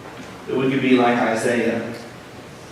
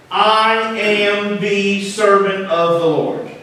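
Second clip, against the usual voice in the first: slow reverent reading; 7 semitones higher, much slower than usual. Shown as a demonstration. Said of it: "Behold, see, perceive, experience".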